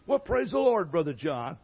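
high, loud hearty greeting; a beaming churchman hailing a friend; imitating Ralph's greeting.

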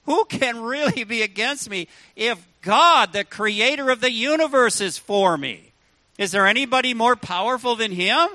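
preacher voicing his own defiant faith, loud and triumphant; triumphant declaration. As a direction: throw the question out to the whole room. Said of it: "I've been able to say".

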